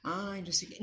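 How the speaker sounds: mock pious brush-off; fake forgiveness. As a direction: shrug it off as a half-hearted lie. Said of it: "Mas eu vou mentir".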